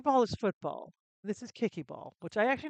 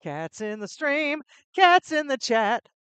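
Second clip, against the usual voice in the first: slow, rising, louder chant; sing-song cat call; 6 semitones higher, 9 dB louder, slower than usual. Real, not acted. Streamer sings out to her cat joining stream. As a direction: croon it playfully to the cat.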